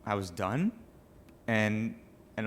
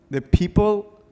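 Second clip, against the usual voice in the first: choking up; 11 semitones higher, 9 dB louder, slower than usual. Real, not acted.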